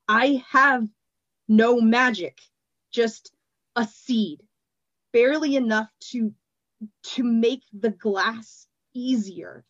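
slow, strained, lightly voiced denial; a touch of strained man. A narrator's reading. Glassblower denying magic to Triss, a touch strained. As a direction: push the words out with effort.

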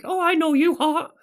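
very high cartoon mouse voice; Mickey voice. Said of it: "he's like".

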